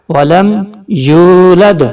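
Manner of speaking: یولد with the و held long; stretched vowel. Shown as a demonstration. Teacher showing the stretched واو. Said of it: "Urdu میں دیکھیے".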